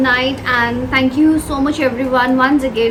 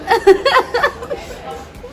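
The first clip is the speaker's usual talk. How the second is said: high laugh; 10 semitones higher than usual. Real, not acted. Spicy challenge, lips burning.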